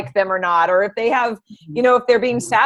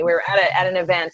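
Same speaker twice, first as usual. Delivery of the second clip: ordinary talk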